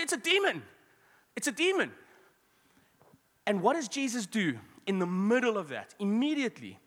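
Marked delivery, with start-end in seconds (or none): 0.0-1.9 high, loud cry of terror; freaking out. Acted. Preacher voices terrified disciples seeing Jesus on the water, freaking out. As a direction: shriek it in mock panic. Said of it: "while freaking out".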